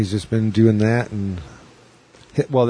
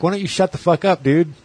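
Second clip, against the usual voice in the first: much higher, mock-indignant retort; comedian snapping back; 9 semitones higher than usual. Comic on stage telling off the drunk heckler, high and mocking. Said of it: "He's like".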